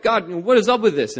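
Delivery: imitated frustrated prayer. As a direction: retell complaining to God in frustration. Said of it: "go to God in prayer and frustration".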